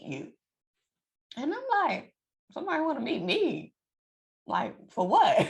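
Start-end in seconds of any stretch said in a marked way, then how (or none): 2.5-5.4 high, puzzled voice of surprise; surprised. Retold or imitated. To the co-pastor, a high surprised question. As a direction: ask it high and baffled, half laughing.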